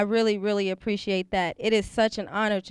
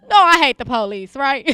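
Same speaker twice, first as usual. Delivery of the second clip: loud, teasing fake confession; mock provocation; 9 dB louder than usual. Host teasing the sheriff, loud mock provocation. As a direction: say it loud to stir the room.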